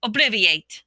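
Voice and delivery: loud ringing spell cast; yelled spell. A narrator's reading. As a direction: yell the incantation. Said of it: "over his head and yelled".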